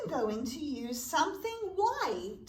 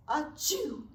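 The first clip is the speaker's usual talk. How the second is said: a big pretend sneeze; hammed-up bear sneeze; mock sneeze. Teacher acting the bear's sneeze for the children, mock sneeze.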